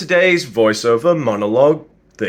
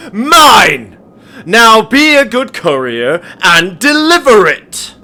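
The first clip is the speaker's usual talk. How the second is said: higher and much louder, building to a shouted "mine!"; furious tycoon; 7 semitones higher, 8 dB louder, slower than usual. Acted. Game tycoon rages at the courier over his chip, shouting. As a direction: let the rage erupt, louder and louder.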